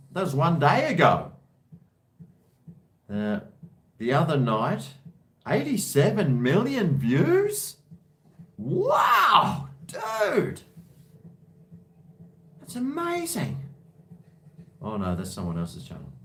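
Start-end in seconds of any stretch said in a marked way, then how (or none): 5.5-13.6 very high, astonished exclamation; amazed. Real, not acted. Vlogger spotting millions of views, high amazed outburst. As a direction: let the disbelief shoot your pitch right up. Amazed at a huge view count.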